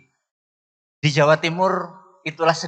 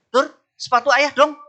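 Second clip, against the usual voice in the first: calling the son louder and higher; raised voice; 11 semitones higher, 4 dB louder than usual. Retold or imitated. Father calling his son a second time, louder. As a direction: call again, pitch pushed way up. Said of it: "nada ditinggiin kan".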